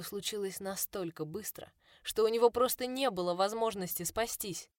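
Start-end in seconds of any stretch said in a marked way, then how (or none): none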